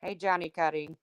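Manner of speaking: ordinary talk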